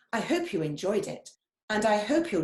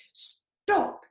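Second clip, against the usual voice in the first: sharp high stop; strong cry; 14 semitones higher, 3 dB louder than usual. Acted. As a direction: stop them in their tracks. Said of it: "his voice was strong".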